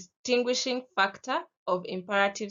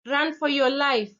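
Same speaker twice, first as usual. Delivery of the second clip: urgent shouted command, pitched up; shouted warning; 6 semitones higher, 5 dB louder than usual. Retold or imitated. Driver shouting at a walker to flee, loud and urgent. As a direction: yell it to save someone. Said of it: "whoever is driving the car shouts to you".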